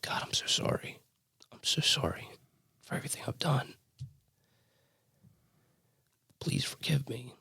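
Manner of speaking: a high, breathless whisper of repentance; tearful whispered prayer. Retold or imitated. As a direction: beg God for forgiveness under your breath.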